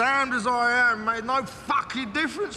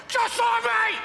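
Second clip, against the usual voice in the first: furious shout; 12 semitones higher than usual. Acted. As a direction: hurl it at him. Climax of angry rant.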